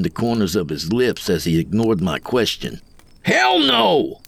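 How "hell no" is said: high-pitched indignant cry; emphatic refusal. A narrator's reading. Greenhorn refuses Coyote's offer, high and emphatic. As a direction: refuse outright, voice shooting up.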